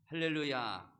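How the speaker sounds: loud, high exclamation of praise; calling out. Real, not acted. Preacher closing the Bible account for the congregation, loud exclamation. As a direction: proclaim it loudly to the congregation.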